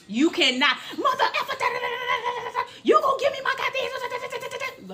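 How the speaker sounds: very high ranting babble; squealed tirade trailing into gibberish; mock going off. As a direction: imitate an irate caller who won't stop. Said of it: "when I say she went off".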